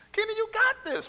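very high-pitched mock self-encouragement; self pep talk. Preacher retelling his morning pep talk to himself, high-pitched. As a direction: pitch it way up, talking yourself into it.

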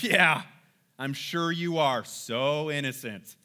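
loud "yeah", then slow mock agreement; sarcastic disbelief. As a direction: draw it out, not buying a word. Acted sceptical reply to brothers' claim.

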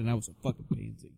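usual speaking voice

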